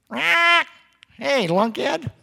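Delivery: high squawking parrot voice; cartoon parrot heckling; squawky parrot. Parrot voice in told story.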